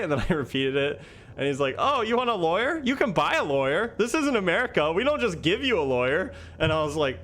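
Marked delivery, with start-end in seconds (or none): 1.8-6.3 high-pitched, scornful imitation; mock scoffing. Officer answering a detainee's lawyer request, mock scoffing.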